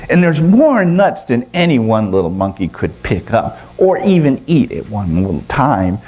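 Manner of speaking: usual speaking voice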